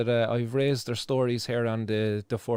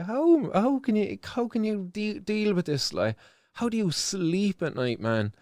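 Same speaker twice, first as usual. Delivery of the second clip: high, slow disbelieving plea; anguished incredulity, pitch raised; incredulous plea; 6 semitones higher than usual. Real, not acted. Outraged plea about suffering children.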